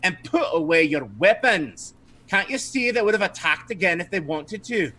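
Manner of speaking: high put-on scolding madam; Madame Dimitri. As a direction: chide them as the indignant madam. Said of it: "and she says".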